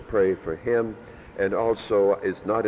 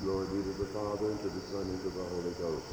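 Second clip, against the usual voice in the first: soft, quick murmured Lord's Prayer; hushed prayer; 12 dB quieter, faster than usual. Real, not acted. Priest leading the kneeling congregation in a hushed prayer. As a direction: pray it quietly with the kneeling congregation.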